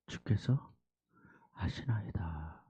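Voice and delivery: slow soft unvoiced whisper. Peter humbly answering Jesus, slow whisper. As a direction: answer humbly, barely breathing.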